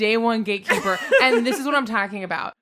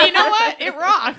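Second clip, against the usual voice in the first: mock hype; 10 semitones higher, 5 dB louder than usual. Mock cancer rebrand pitch.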